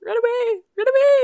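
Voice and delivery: high, loud mock yelp to flee; mock panicked cry. Friend acting out a panicked escape, high mock yell. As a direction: squeal it like fleeing in fake terror.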